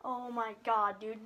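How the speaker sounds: ordinary delivery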